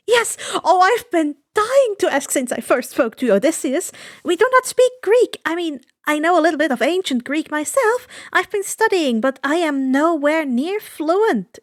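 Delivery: high, loud eager rush; bursting with eager questions; excited. A narrator's reading. Annabeth finally asking the men, excited. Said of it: "Annabeth says excitedly".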